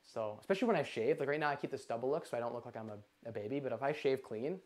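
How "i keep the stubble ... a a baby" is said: ordinary delivery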